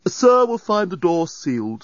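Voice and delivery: haughty, drawn-out formality; sniffy. A narrator's reading. Doorbot rebuffing Dan at the door, sniffy. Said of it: "sniffed the doorbot".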